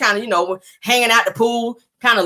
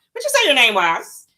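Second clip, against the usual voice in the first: Simon's voice; 5 semitones higher than usual. Retold or imitated. Mocking Simon offering Portia a drink. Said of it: "that's how he sound".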